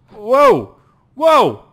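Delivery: loud rising cries of surprise; startled whoa. Real, not acted. Gamer watching a gunfight, shouting whoa. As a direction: yell out in alarm.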